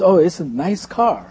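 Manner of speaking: ordinary delivery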